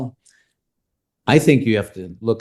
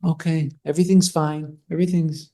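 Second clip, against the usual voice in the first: higher-pitched imagined inner voice; voicing her thoughts; 8 semitones higher than usual. Guest voices the catfisher's thinking, higher mock inner voice. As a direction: imitate someone breezily wrapping things up. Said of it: "in her mind".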